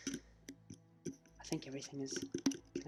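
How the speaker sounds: breathy half-whisper to himself. Real, not acted. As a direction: mutter it under your breath. Muttered aside while checking.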